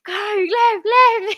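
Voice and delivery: high-pitched shout of urgent pitch-side calls; imitated shouting. Remembering shouts during the goal, imitated shouting. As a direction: call it out urgently, mimicking a player. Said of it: "like, shouting".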